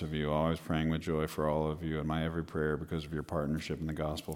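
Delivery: low, quiet monotone Bible reader; Mister Monotone. Acted. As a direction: drone through scripture like Mister Monotone, barely any tune. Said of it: "Mister Monotone".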